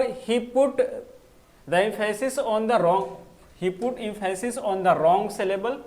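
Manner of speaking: each English word read out slowly and clearly; a teacher reading an example sentence aloud, slowly; slow dictation. Shown as a demonstration. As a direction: spell the sentence out for students to copy. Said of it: "उसने गलत syllable पे जोर डाला".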